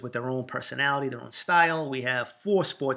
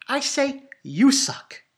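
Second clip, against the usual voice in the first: much higher, louder and slow, drawn out; cocky comeback; 10 semitones higher, 6 dB louder, much slower than usual. Acted. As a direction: throw it back as a taunt. Comeback to Boston haters.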